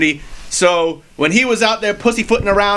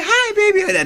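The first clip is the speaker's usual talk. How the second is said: much higher, sing-song mom coo; mock sweet mom; slower than usual. Wrestler mocks the sweet greeting his mother never gave, cooing much higher. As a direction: coo it way up high like a doting mother. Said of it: "she didn't say".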